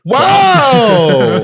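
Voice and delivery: high, loud, overblown wow; imitating gushing fans in fake awe; mock amazement. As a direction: stretch out a hammed-up wow. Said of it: "and people are like".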